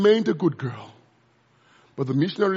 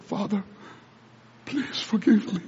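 tearful plea; 5 dB quieter than usual. Retold or imitated. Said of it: "she began to cry".

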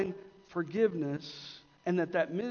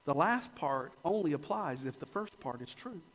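ordinary talk

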